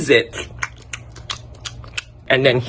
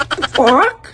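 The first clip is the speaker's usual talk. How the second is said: surprised; 12 semitones higher than usual. Retold or imitated. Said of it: "I was like".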